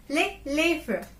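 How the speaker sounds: It's les lèvres said slowly, lèvres with a clear v and r; slow right down on les lèvres for learners; slow repeat after me. Shown as a demonstration. Teacher slowing les lèvres for students to repeat. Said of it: "Repeat after me".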